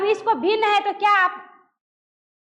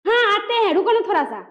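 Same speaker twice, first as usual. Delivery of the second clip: mildly irritated, raised "हाँ"; annoyed reply; 3 semitones higher than usual. Shown as a demonstration. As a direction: answer as if losing patience. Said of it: "गुस्सा चढ़ा हो तो". (हल्के गुस्से से)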